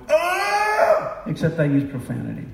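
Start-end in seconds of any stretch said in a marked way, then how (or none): 0.1-1.2 sudden high shouted cry; anguished scream. Retold or imitated. Grieving grandfather in the car, screaming. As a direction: let out a raw scream of grief. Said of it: "and then I went".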